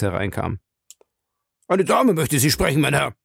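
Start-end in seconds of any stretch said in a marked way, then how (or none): 1.7-3.2 higher-pitched subordinate's voice; a dutiful officer announcing a visitor; night-duty officer. Acted. Night officer announces a lady to Jim, in a higher officer's voice.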